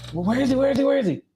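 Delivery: high eager where is he; excited high calls. Real, not acted. Looking for the squirrel.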